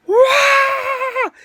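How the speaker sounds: long, high, loud shriek; startled scream. Retold or imitated. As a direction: scream as if a mouse ran over your foot. Retold fright, mouse over foot.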